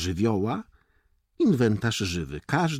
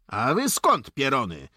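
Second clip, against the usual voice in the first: raised, high challenge; officer's call; 7 semitones higher, 4 dB louder than usual. A narrator's reading. Corporal challenges the boys on the road, calling out. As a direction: call out sharply to strangers, voice up. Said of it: "zawołał podoficer".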